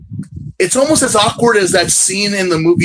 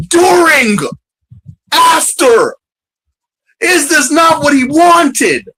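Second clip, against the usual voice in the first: much higher, louder outraged cry; exasperated shout; 9 semitones higher, 4 dB louder, slower than usual. Real, not acted. Fed up with crying Ren, loud disbelieving shout. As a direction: rant in disbelief.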